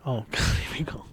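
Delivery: whispered with a laugh; breathy laugh under the breath. Real, not acted.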